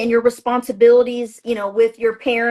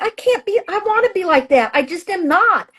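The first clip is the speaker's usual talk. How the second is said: higher, pleading voice, like a frustrated younger self; mock complaint pitched up; exasperated self-quote, raised pitch; whiny self-quote; 7 semitones higher than usual. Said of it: "I'm like".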